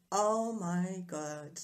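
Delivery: drawn-out, slow "oh my God"; retold dismay. New mother recalling the couple's reaction to the baby's scream, retold dismay. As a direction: stretch it out in mock dismay.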